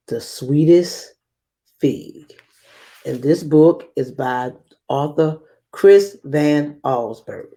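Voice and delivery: usual speaking voice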